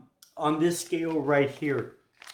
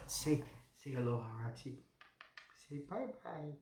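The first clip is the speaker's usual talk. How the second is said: softer, hushed coaxing to Roxy; 14 dB quieter than usual. Real, not acted. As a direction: gently coax a little one to wave bye.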